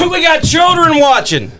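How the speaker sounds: high, half-laughing mock shout; mock outrage shout. Co-host shouts about kids watching after the swear, mock outrage. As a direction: play the shocked guardian of the kids. Scolding a swear word on air.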